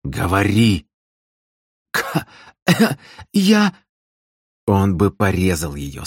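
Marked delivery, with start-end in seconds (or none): 0.0-0.9 insistent growled command; menacing repeated demand; growled order. A narrator's reading. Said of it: "прорычал в красное лицо". 1.9-3.7 high, strangled stammer with coughs; choking. A narrator's reading. Choked girl tries to speak.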